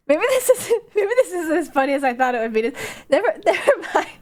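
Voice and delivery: laughing while talking; giggling through the words. Real, not acted. Laughing at own story.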